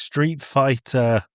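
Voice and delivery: usual speaking voice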